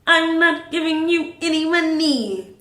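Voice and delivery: high, slow, emphatic refusal; defiant refusal. Retold or imitated. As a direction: draw out the refusal, pitched high. Refusing the cheating driver.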